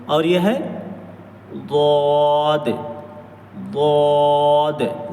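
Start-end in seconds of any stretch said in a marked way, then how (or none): 1.5-5.1 ضاد held long, heavy and thick; drawn-out dad demo. Shown as a demonstration. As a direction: stretch ضاد slowly from the side of the tongue. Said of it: "زبان کی کروٹ".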